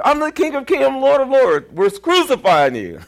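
high, loud sneering mockery; mocking crucifiers. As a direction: taunt the king in a high voice.

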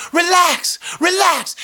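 high, loud pleading yell; frantic shout. Retold or imitated. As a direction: shout him down while waving your hands. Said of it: "hands flailing".

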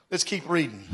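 ordinary delivery

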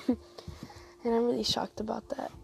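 coughing through the words; coughing fit. Real, not acted. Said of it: "I'm coughing so much".

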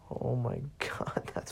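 breathless whispered giggle; slow wheezing laugh; whispered laughter. Real, not acted. Winding down from laughter.

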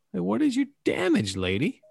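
exasperated. Retold or imitated. Mocking annoyed commenters.

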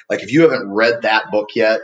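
usual speaking voice

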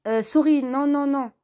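panicked quick refusal; flustered refusal. Acted. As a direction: back away fast, rattled.

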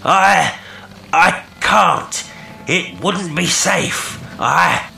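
high, halting call from afar; faltering, breaking off. A narrator's reading. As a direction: falter between words, then stop short. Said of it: "The voice broke off".

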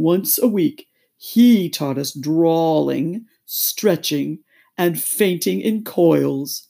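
loud, drawn-out listing; ponderous, stretched turtle voice; doleful Mock Turtle. A narrator's reading. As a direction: count off subjects slowly. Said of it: "counting off the subjects".

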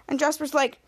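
ordinary delivery

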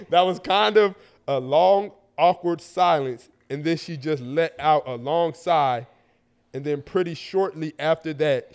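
slow, drawn-out read-aloud; unhurried, deliberate letter reading. A narrator's reading. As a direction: read the story slowly, phrase by phrase.

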